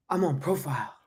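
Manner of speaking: soft, drooping excuse to a sergeant; quiet mock plea of being excused; reel impression. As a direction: mimic a feeble soldier's excuse. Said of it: "that reel? It's like".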